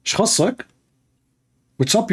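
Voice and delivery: put-on high-pitched Saudi Arabic accent; sharp Saudi accent, irritated. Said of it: "in Saudi accent".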